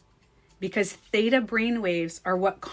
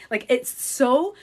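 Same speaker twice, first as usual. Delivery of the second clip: ordinary delivery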